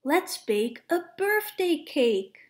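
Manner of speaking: ordinary delivery, nothing marked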